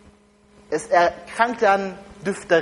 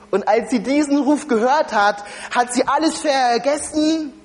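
ordinary talk, nothing marked

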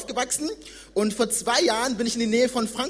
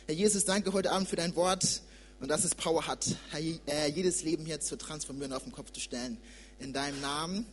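quiet prayer, fading softer to the end; 5 semitones lower, 8 dB quieter than usual. Real, not acted. Preacher prays over the congregation, soft and fading. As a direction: pray softly over the room.

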